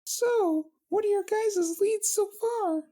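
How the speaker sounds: squeaky high princess voice, friendly; high pony princess. Acted. Twilight voiced in the reading.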